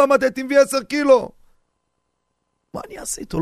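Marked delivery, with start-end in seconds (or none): 2.7-3.4 mock whispered aside. Stingy host mutters aside.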